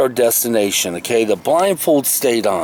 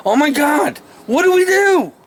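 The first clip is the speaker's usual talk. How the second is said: retold panic; 11 semitones higher than usual. Lost teen in a field, retold high panicky cry. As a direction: voice jumps high, mock-panicked.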